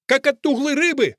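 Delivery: high-pitched outcry; Fusia. A narrator's reading. Fusia presses the old man about the smell, crying out. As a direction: throw it out as a high cry. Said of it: "кричит Фусия".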